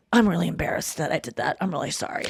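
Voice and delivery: low, softened retold apology; imitating a subdued, contrite man; sheepish apology. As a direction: drop low and quiet, apologizing as him.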